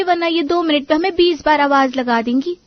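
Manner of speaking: ordinary delivery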